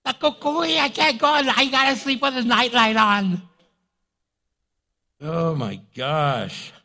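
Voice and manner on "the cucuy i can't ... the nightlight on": high, wailing grown-up scared of bogeymen; mock terror. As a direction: whimper loudly in exaggerated fright. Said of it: "They hear someone crying".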